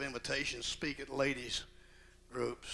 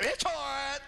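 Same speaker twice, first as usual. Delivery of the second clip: high, blunt snapped question; grumpy mother-in-law; 13 semitones higher, 4 dB louder than usual. Retold or imitated. Mother-in-law answering son-in-law's call, high and curt. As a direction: answer the phone with a snap. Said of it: "How's the church".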